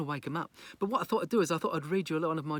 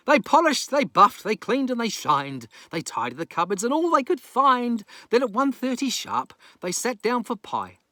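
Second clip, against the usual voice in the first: higher, louder rhyming read-aloud; storyteller racing to the finish; lively story narration; 5 semitones higher, 9 dB louder than usual. Acted. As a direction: bounce through the rhyme.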